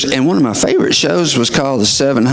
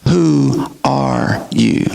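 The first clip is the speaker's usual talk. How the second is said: deep, slow searching question; low, slow insistence; 11 semitones lower, much slower than usual. Retold or imitated. Doctor pressing Sheila again, low and slow. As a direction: drop low and press her.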